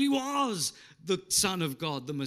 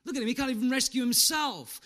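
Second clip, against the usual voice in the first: jeering, sneering voice; mock him like the crowd at the cross; taunting mockery, raised pitch; 5 semitones higher than usual. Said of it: "they started to snigger and mock".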